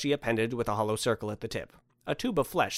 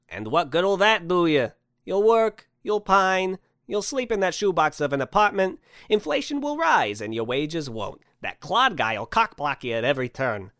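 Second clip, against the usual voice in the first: louder, raised character voice; scolding Santa; 6 semitones higher, 5 dB louder than usual. Acted. Santa lectures stranded man on his miserable life, loud scolding. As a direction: lay out his grim prospects forcefully.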